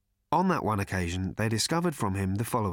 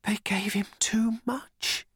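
thin, high, slow whimper; slow high-pitched pitiful whisper; tiny whimper; 11 semitones higher, slower than usual. A narrator's reading. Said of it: "in a tiny whimper".

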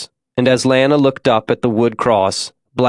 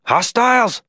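acted yell; 12 semitones higher than usual. A narrator's reading. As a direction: call out a warning high, held back in volume. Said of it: "someone yelled".

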